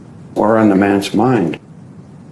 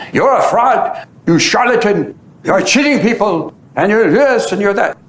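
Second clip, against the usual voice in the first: high-pitched mock accusing yell; retold shouting abuse; 8 semitones higher than usual. Two frustrated men shout insults at Baba, high mock yell. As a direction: hurl insults at a holy man, pitched way up. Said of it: "started shouting abuses at him".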